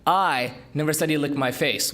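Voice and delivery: "I" punched, rest of the line light; stress on I. Shown as a demonstration. Speaker demoing word stress to an audience, hitting "I". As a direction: lean hard on "I" as if someone else said it. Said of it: "emphasize different words".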